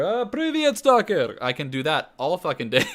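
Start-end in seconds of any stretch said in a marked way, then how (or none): 0.0-1.4 put-on very high, loud Stalker greeting in an Eastern European accent; Stalker impression. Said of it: "But I can emulate Stalker".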